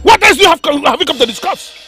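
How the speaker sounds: high, loud scolding; enraged king silencing the adviser; furious tirade. Acted. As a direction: tear into the interrupter.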